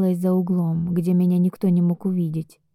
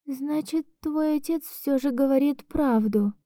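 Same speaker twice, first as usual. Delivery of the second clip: resigned; 7 semitones higher, 4 dB quieter than usual. Acted. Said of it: "прекращая плакать".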